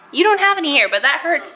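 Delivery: ordinary talk, nothing marked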